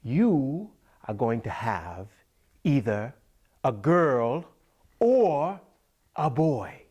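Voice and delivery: slow, emphatic pronouncement in chunks; loud, swooping seer voice with long pauses; old wise woman's prophecy. Acted. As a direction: draw out each part like a grand revelation. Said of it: "And she said".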